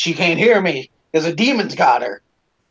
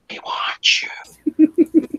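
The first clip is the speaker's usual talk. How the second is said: very slow whisper, higher, stretched out; drawn-out creepy whisper; 11 semitones higher, much slower than usual. Retold or imitated. Hidden voice luring the scared kid, slow whisper. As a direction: drag the words out in a ghostly whisper. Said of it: "The next thing I hear".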